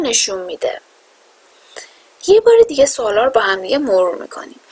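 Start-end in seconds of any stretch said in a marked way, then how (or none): none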